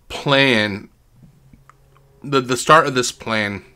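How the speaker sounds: ordinary delivery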